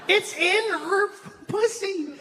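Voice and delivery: slow, very high-pitched incredulity; incredulous. Real, not acted. Comic arguing the obvious to the crowd, incredulous. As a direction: stretch it out way up high in disbelief. Comic outrage at abortion debate.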